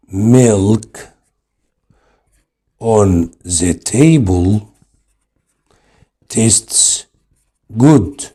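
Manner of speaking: very slow, word by word; slow dictation. Shown as a demonstration. Teacher to students, dictating the example very slowly. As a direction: read the example out slowly for copying.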